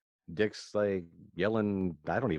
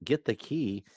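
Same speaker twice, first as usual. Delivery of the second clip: mock urgent line, drawn out and higher at the end; acted movie quote; 9 semitones higher, much slower than usual. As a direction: voice climbing high on the second part. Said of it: "so he actually goes".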